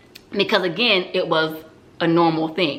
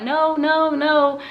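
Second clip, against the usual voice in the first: much higher-pitched retold protest; mock tearful denial; 8 semitones higher, much slower than usual. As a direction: imitate an upset teen insisting, pitched up high. Retold tearful answers to doctor.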